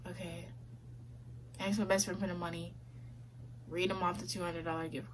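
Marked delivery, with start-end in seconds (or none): 0.1-0.5 defeated sigh. Retold or imitated. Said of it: "And I'm like".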